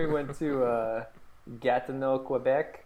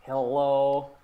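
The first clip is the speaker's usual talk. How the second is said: grudging clerk. Retold or imitated. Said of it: "everything just like".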